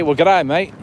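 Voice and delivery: hammed-up Australian hello; Australian catchphrase. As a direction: put on an Aussie accent, loudly. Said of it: "that that's my thing".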